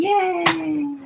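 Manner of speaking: high, drawn-out yay; happy cheer. Real, not acted. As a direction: cheer it high and let it trail. Cheering her next sewing step.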